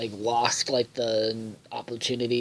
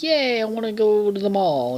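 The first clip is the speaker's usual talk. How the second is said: Beth, excited; 8 semitones higher, 4 dB louder than usual. Retold or imitated. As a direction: imitate a kid squealing yay. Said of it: "Beth is all excited".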